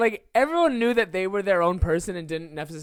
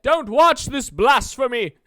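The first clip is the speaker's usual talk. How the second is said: high, loud, slow put-on warning; mock outraged church; 5 semitones higher, 7 dB louder, slower than usual. Church warning people off a movie, in mock outrage. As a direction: bellow a mock-pious decree, drawing it out.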